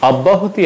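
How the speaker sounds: অব্যাহতি with an open "aw" first vowel; wrong "awb-bahoti" for অব্যাহতি; wrong pronunciation. Shown as a demonstration. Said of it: "অব্যাহতি হবে না".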